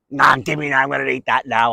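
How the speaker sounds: mock greedy voice, sustained and run together; slave to appetite. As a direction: act out someone grabbing food on impulse.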